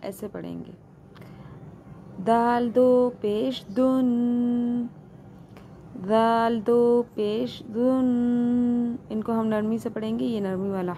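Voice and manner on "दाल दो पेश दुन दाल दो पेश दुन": slow drawn-out spelling drill; recitation drill. Shown as a demonstration. Quran teacher drilling students through the letters slowly. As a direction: stretch each syllable with nasal ghunna.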